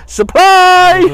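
a sudden high, loud yell; yelled punchline. Retold or imitated. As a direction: leap out and yell it.

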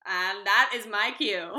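slow, loud grand sign-off; mock dramatic exit. Real, not acted. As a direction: announce your exit theatrically. Mom exiting podcast dramatically.

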